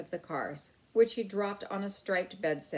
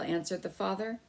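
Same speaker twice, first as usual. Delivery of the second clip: ordinary delivery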